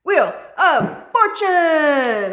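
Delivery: high, loud, very drawn-out cry of the card name; excited card reveal. Real, not acted. Reader calls out the card she just pulled, thrilled. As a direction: announce the card like a big surprise.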